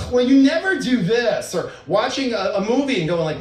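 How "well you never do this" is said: loud, higher huffy reproach; accusing complaint. Acted. Partner complaining instead of praising, accusingly. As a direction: imitate a partner griping, raised voice.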